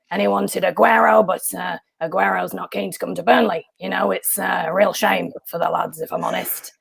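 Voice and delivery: a growly Burnley manager's voice, gruff and matter-of-fact; Sean Dyche. Acted. Impressionist doing Sean Dyche for the host, in a growly manager's voice. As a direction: talk like a weary football boss after a match. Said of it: "your Sean Dyche impression".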